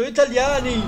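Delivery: loud jeering call of a foreign tourist; mock German shout. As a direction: bark it like a mocking German. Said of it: "Di certo stanno dicendo".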